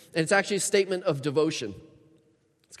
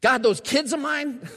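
higher, louder fed-up cry to God; mock exasperation, raised and slowed; exasperated complaint; 7 semitones higher, 6 dB louder than usual. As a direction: vent about the office and the kids. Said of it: "You might write it".

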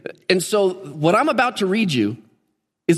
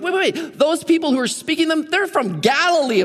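lightly acted disbelief, pitched up; retold incredulous protest; mock astonishment; 7 semitones higher than usual. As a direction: play a baffled onlooker in a higher voice. Acting out the astonished Pentecost crowd.

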